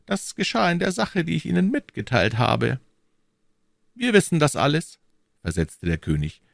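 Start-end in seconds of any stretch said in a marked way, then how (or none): 0.1-2.8 higher, measured courtly cardinal; cardinal's voice. A narrator's reading. 3.9-5.0 higher royal voice, firm; the king's voice, raised pitch. A narrator's reading.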